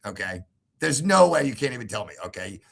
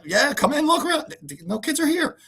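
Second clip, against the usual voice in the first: much higher, airy mocking voice; mock breezy wife. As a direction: imitate her offhand invite. Wife's dismissive invitation.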